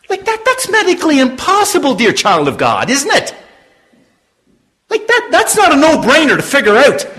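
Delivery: loud, high, ringing preacher voice; full-force preaching. Acted. Preacher calling out to his congregation, full-force preaching. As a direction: preach it out to the whole room.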